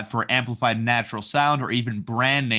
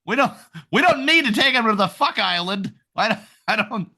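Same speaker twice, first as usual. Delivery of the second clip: panicked voices; 7 semitones higher than usual. Retold or imitated. Voicing panicked guards, high.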